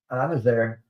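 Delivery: usual speaking voice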